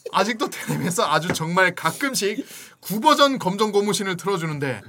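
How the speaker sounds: usual speaking voice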